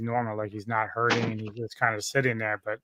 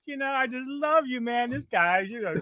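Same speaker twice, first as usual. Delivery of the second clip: put-on much higher, sloppy drunken declaration; drunk Wells; 5 dB louder than usual. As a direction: gush it like a sentimental drunk. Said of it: "He's like".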